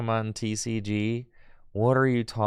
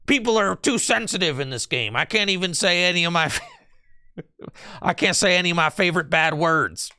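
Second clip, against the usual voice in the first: high, hammed-up complaining; mock alt-right complainer; 6 semitones higher than usual. Streamer imitating chatter griping about sensitivity, mocking. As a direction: mimic a griper, laughing partway through. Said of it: "that's what you sound like".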